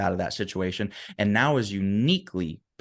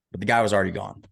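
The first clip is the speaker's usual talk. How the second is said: ordinary talk, nothing marked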